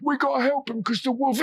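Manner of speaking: put-on a fussy old villager rushing to help; old man in a hurry. A narrator's reading. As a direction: gabble as you run uphill. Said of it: "old man running, he said".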